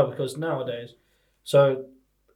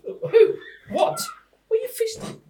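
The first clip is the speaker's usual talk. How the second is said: very high-pitched, incredulous questioning; mock policeman. Policeman hears friend's odd confession, high-pitched disbelief. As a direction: squeal the questions as a baffled comic officer. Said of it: "You be the policeman".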